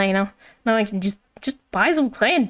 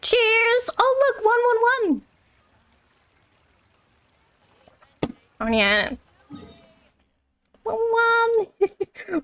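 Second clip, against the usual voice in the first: much higher, bubbly excitement; excited; 10 semitones higher, much slower than usual. Real, not acted. Streamer spots the rewards, cheering excitedly. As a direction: squeal with delight at the loot.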